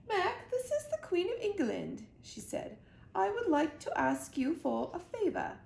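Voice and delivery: high, regal queen voice. A narrator's reading. The Queen asks young Mac a favor, regal and high. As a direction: ask the favor like royalty.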